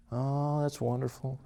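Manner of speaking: ordinary delivery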